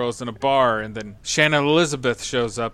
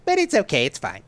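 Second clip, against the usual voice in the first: mock placating; 6 semitones higher than usual. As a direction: parody a writer backing down. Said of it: "you're like".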